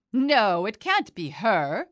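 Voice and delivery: lightly voiced scornful stepmother; wicked stepmother. A narrator's reading. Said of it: "said the stepmother".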